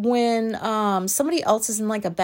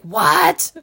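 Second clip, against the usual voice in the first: wife raising voice; 4 semitones lower, 6 dB louder than usual. Retold or imitated. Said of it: "She was raising her voice".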